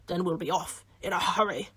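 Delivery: ordinary delivery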